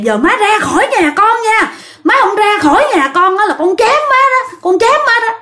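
high, heated threat, words pouring out; furious threat. Retold or imitated. Son threatening to chase his possessed mother out, furious. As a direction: threaten her in a torrent. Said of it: "nói xối xả".

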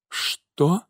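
small, high, stunned question; shocked babble. A narrator's reading. Mother hears of her stabbed son, stunned babble. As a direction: barely get it out, rising in shock. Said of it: "пролепетала Ираида".

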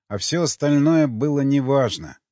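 ordinary delivery

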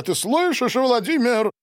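high-pitched, incredulous question; astonished appeal. A narrator's reading. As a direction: appeal to a friend in amazement. Silvio exclaiming in amazement. (изумлённый вопрос высоким голосом)

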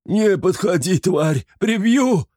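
high, loud panicked threat; terrified shout. A narrator's reading. Sher shouts at the creature in terror. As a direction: yell at the monster in terror. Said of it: "крикнул он".

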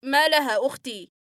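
patient screams back at the doctor; shouting. Acted. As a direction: yell it at the top of his voice. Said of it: "وصرخ بأعلى صوته".

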